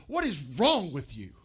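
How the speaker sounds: acted-out fed-up outburst; exasperated outburst. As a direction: burst out, leaning hard on wrong. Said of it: "She's irritated".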